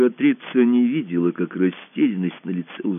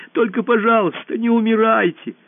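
high, pleading cry; tearful plea; 14 semitones higher, 4 dB louder than usual. A narrator's reading. Alisa begging the dying empress, tearfully pleading. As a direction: beg in a high voice, close to tears. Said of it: "заплакала она".

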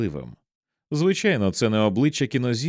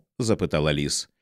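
plain reading voice

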